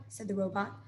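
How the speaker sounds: plain reading voice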